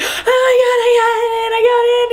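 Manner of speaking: overwhelmed telling mom; tearful joyful shout. Retold or imitated. Phoning mom, crying with joy.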